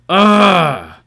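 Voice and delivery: loud, high disappointed "Ugh"; crowd groan. Retold or imitated. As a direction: groan like the whole table lost. Table groans at bad roll.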